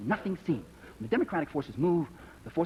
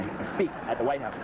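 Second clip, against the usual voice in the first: ordinary talk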